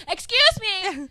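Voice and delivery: loud, slow, high excuse me; fed-up shopper finally shouting; exasperated. Retold or imitated. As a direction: lose patience and belt it. Patience runs out in aisle.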